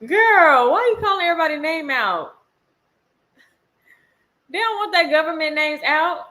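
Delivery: loud, high parental call; exasperated scolding. Real, not acted. Scolds child across room during live.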